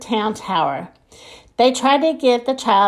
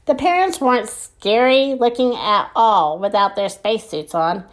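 usual speaking voice

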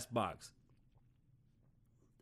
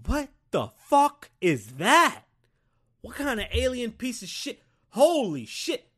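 loud, high-pitched shock, drawn out; stunned disbelief; 10 semitones higher, 10 dB louder than usual. Acted. Man seeing a gorilla for the first time, stunned disbelief. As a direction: gape at something you've never seen. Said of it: "you're just sitting there like".

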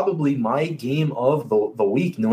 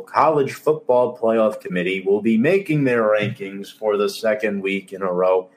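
usual speaking voice

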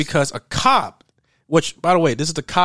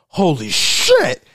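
loud, high, drawn-out astonishment; mock shock; 5 semitones higher, 6 dB louder, much slower than usual. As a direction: stretch it out in stunned, loud disbelief. Partner's shock on seeing the robbers.

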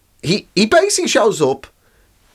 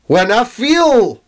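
put-on drunk Scottish Elon; 2 semitones higher than usual. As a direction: slur it like a Scot at the bar. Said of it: "all of it is whiskey".

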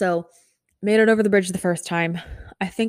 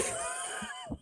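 very high breathless squeal of a laugh; 17 dB quieter than usual. Real, not acted. Fan squeals with laughter after asking for a hug. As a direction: let out a giddy squeal.

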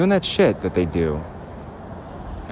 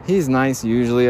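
mock casual; 4 semitones higher than usual. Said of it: "And just casual".

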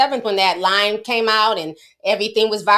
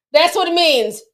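ordinary talk

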